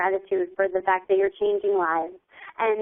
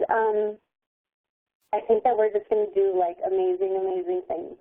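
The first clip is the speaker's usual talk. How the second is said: ordinary talk, nothing marked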